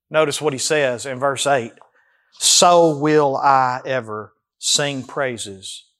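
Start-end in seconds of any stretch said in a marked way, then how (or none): 2.4-5.9 slow, deliberate So will I; preacher slowly declaring the vow; slow declaration. Acted.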